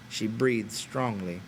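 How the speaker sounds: ordinary delivery, nothing marked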